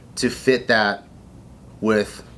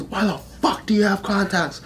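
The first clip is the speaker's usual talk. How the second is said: higher-pitched instructor interrogation; mock drill instructor; 9 semitones higher than usual. Drill instructor questions recruit about contacts, mock demand. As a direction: imitate an incredulous instructor, pitched up. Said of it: "He goes".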